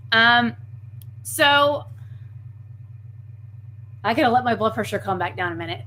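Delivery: ordinary talk, nothing marked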